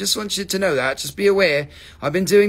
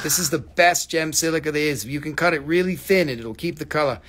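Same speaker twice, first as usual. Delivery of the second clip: ordinary talk, nothing marked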